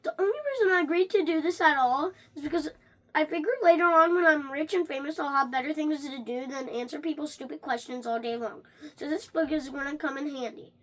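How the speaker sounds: ordinary delivery, nothing marked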